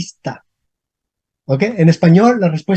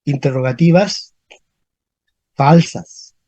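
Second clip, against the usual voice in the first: low, separated "Interrogativas ... falsas"; long pause between "Interrogativas" and "falsas"; dictating; 6 semitones lower, slower than usual. Shown as a demonstration. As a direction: repeat "Interrogativas falsas" in two low, measured pieces. Term repeated slowly for the class.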